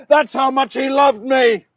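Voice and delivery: loud, forceful declaration; emphatic outcry. Acted. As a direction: proclaim it with full force. Judas declaims his confession, then repeats.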